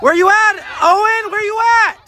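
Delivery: yelling from the sidelines. Real, not acted. Coach shouting across the field.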